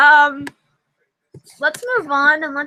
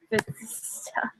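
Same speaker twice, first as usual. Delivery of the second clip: slow breathy whispered echo; 6 semitones lower, 15 dB quieter, slower than usual. Shown as a demonstration. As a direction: whisper it back to yourself. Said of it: "And I am just doing stupid stuff".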